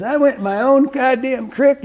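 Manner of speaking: put-on high, mildly outraged old vet; griping about his own creek; indignant old man. Quoting the eighty-eight-year-old fisherman, a touch of outrage. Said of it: "he says".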